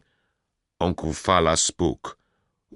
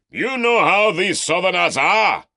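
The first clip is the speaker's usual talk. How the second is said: much higher, louder, sneering bellow; mock the Southerner for the mob; scornful shout; 8 dB louder than usual. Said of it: "Uncle Fala shouted".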